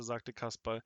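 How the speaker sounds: usual speaking voice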